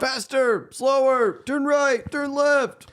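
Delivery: mock commands. Mocking movie's riding scene.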